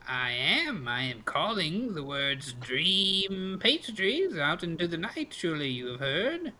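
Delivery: drawn-out, swooping crone voice; old pastry seller. Acted. Old lady tells customer of her wares, drawn-out crone voice. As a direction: stretch the words like an old street seller.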